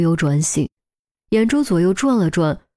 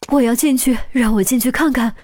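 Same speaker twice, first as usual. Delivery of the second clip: urgent mother; 5 semitones higher than usual. Acted. Mother rushing to ICU.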